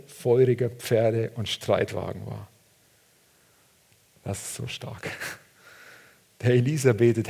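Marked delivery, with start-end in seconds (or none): 4.2-5.1 breathy whispered wonder; awed whisper. Real, not acted. Preacher moved by the story, whispering in awe. Awed aside after reading.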